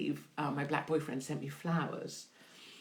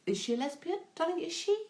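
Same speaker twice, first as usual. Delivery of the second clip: high questioning voice; 12 semitones higher than usual. Retold or imitated. Reading a viewer's question aloud.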